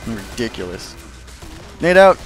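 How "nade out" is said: sharp loud callout to teammates; grenade warning yell. Real, not acted. Calling out a grenade in game.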